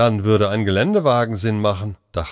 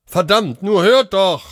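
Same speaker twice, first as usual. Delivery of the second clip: much higher, louder angry cry; desperate cursing; 11 semitones higher, 5 dB louder than usual. A narrator's reading. Doctor cursing at the silent line, desperate anger. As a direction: curse in desperation.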